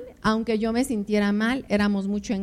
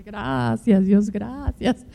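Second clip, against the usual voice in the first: mock prayer; much slower than usual. Model prayer of thanks.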